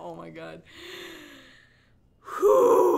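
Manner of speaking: ordinary delivery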